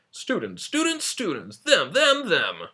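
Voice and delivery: loud, high hype announcer chant; monster truck ad. Pretend. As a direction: pump it up like a truck rally ad. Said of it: "I sound like a Saturday morning, uh, monster truck commercial".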